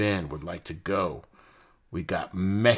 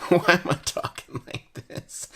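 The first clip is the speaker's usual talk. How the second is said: laughing, voice pushed high; 11 semitones higher, 5 dB louder than usual. Real, not acted. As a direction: crack up mid-question. Laughs at his own odd voice.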